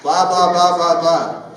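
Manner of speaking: loud, slow mock chatter; loud mock babble; fast gibberish. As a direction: imitate rapid talk as blah blah. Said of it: "it was like".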